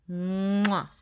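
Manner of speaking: long "mmm" into a smack; kiss sound. A narrator's reading. Frogalina kissing her pumpkin, exaggerated kiss. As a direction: make a big drawn-out kiss noise. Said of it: "and kissed it".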